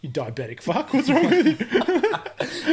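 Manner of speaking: high, slow, laughing put-down; mock outrage. Friend scorning a Coke chugger, laughing high. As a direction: stretch it out through laughter.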